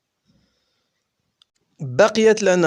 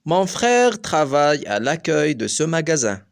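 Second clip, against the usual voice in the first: accueil said slowly and clearly in French, every word separate; slow example sentence; much slower than usual. Shown as a demonstration. Teacher reading a French example sentence slowly for pupils. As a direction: dictate it slowly for the class. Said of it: "الكلمة إذن ستنطق أكاي".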